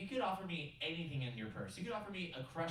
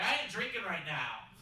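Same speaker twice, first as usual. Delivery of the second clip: higher and louder, a playful indignant refusal; emphatic refusal; 6 semitones higher, 4 dB louder than usual. Retold or imitated. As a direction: shoot it down with mock outrage. Refusing wine, acted exclamation.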